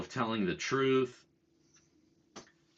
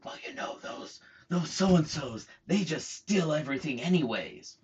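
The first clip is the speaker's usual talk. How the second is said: a hint of a secretive busybody, hushed then higher; creepy confidential mutter; 5 semitones higher than usual. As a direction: lean in and share it like a dirty secret. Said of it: "comes up real close".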